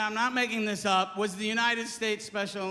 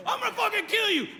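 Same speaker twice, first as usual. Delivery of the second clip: fast, high mock yell; drunk jock threat; 10 semitones higher, faster than usual. Drunk jock wanting his buddies to stop him, mock fury. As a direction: rattle off a blustering high threat. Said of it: "some dumb drunk jock".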